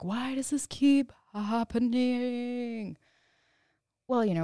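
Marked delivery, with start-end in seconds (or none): 0.0-3.0 higher, slow, bewildered whine; slow, higher mock despair; exasperated question. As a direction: drag it out like you truly can't figure it out. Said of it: "And I was just like".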